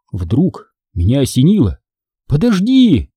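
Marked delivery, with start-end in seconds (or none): 2.3-3.1 high, sudden exclamation; understated shout to halt someone; mild cry. A narrator's reading. Boy struck by an idea stopping Hanna, mildly crying out. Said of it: "закричал я".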